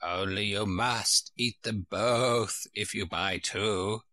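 put-on slow, odd old sheep voice. A narrator's reading. The sheep shopkeeper warning Alice about the eggs, slow and odd. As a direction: drawl it out like a fussy old ewe.

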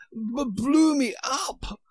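the queen panting to the king after being flung through the air. Acted. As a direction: gasp it out, still out of breath. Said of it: "panted the queen".

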